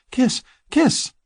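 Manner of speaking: high, insistent female voice; eager woman. Acted. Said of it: "as if she could persuade me".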